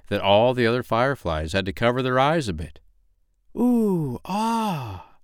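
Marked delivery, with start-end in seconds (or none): 3.6-5.2 high, drawn-out marveling; awed crowd. A narrator's reading. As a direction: gasp in wonder like an audience at fireworks. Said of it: "they said".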